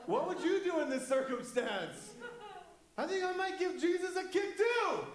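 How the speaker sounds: high-pitched comic protest; mock exasperation. Preacher siding with scared disciples, hammed-up indignation. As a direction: climb into a high, incredulous squeal.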